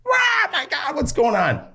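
high-pitched mock scream of a crowd; imitated screaming. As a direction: cry out like startled bar patrons. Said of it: "I hear people screaming".

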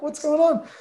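baffled disbelief. Retold or imitated. Baffled by another trade.

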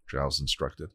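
plain reading voice

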